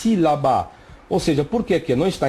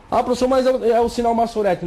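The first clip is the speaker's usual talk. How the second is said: a higher, faster voice for a doubting student; imitated student objection; 7 semitones higher, much faster than usual. Teacher mimicking a student objecting to the professor. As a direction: voice the skeptical student cutting in.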